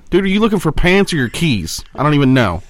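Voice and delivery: usual speaking voice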